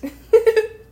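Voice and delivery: bright high laugh. Real, not acted. Laugh after remark.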